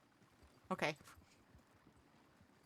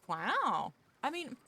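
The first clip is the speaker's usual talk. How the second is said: much higher and louder wow; surprised reaction; 7 semitones higher, 6 dB louder, slower than usual. Real, not acted. Streamer reacting to a viewer naming Meryl Streep, astonished. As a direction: exclaim at the chat's example.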